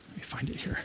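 breathy whisper to himself while searching; whispered aside. Real, not acted. Pastor looking for the verse, whispering to himself. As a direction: mutter it under your breath as you flip pages.

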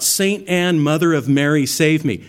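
retold cry for help in the storm. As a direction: call out to a saint in terror. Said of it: "and he cried out".

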